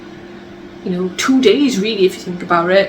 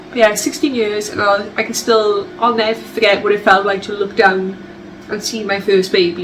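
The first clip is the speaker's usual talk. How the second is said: ordinary talk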